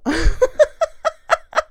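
high burst of laughter; a sudden, bright real laugh. Real, not acted. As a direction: laugh at her own dread. Laughs after "Oh no.

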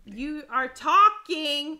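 loud, slow, word-by-word reprimand; scolding. Real, not acted. Wife to interrupting husband, scolding.

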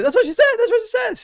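high, loud, gleeful shouting; shouting gleefully. Retold or imitated. Michael Scott going crazy with the joke, shouting. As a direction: yell it out like a man gone wild. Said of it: "and then just start shouting".

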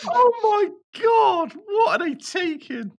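high, loud laughing cry of disbelief; laughing outcry. Real, not acted. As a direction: shout it through helpless laughter. Laughing at breakfast alcohol story.

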